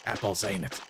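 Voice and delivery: low, menacing Aussie slang; Australian dolphin. Acted. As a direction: threaten in a low Australian accent. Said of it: "is this dolphin Australian?".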